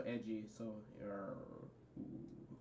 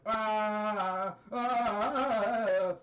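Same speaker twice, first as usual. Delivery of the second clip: long, high wordless "aah"; imitated sun cry; 6 semitones higher, 5 dB louder, much slower than usual. The sun comes up, high mock cry. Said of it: "the sun was like".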